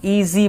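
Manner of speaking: ईजी pulled apart into ee and zee; split into syllables. Shown as a demonstration. Teacher showing two parts of a sound, ईजी split in two. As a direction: stretch both halves of ईजी so each is heard. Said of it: "ई एक हिस्सा है sound का".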